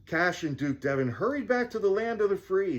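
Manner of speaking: usual speaking voice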